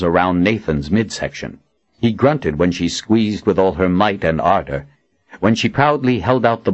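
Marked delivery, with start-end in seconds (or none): none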